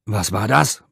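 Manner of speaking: loud, startled demand; angry shout from another room; bellowing. A narrator's reading. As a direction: roar the question out. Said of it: "brüllte de Vries".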